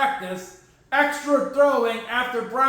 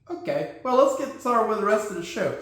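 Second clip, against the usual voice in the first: normal talking voice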